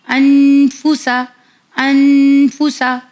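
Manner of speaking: "अनफूसा" with a long hummed n, then short फूसा; held nasal n. Shown as a demonstration. Teacher modelling a Quran word for children, "अनफूसा" with drawn-out hummed n. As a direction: stretch the न of "अनफूसा" into a long hum. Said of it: "मैंने इस तरह पढ़ा था".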